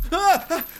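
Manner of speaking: sharp high yelp of fright; startled scream. Acted. Chris yelps in fright at the ghost.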